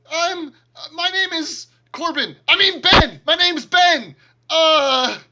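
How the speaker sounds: very high, loud, flustered stammer; frantic, shrill-pitched cover-up; panicked captive. Acted. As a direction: blurt it out in squealing panic. Grappled captive blurts a false name.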